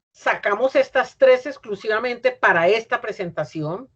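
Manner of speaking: ordinary talk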